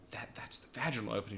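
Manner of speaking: hushed, quick whisper to the teacher. Retold or imitated. Student nurse whispering to his teacher beside the patient, hushed. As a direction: whisper it so the patient won't hear.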